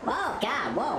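ordinary delivery

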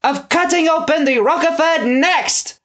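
high loud eager shout. Acted. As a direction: blurt it out greedily. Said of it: "shouted Sir Broderick".

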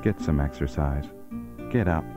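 ordinary talk

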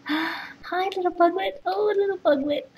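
high gasping coo. Real, not acted. Cooing high at the little buglet. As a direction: gush up high over the tiny one.

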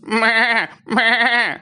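high bleating goat cry. A narrator's reading. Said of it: "అని అడగమంది". (మేకపిల్లలా ఎత్తైన స్వరంలో మే మే)

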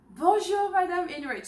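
natural flowing greeting. Shown as a demonstration. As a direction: say "Bonjour, Madame" as one lively phrase. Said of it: "So repeat after me".

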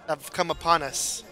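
ordinary delivery

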